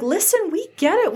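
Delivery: high-pitched reassuring quote; retold understanding. Bad Batch to the kids who stole their ship, retold understanding. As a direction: lift the voice well up, imitating sympathy. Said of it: "They're like".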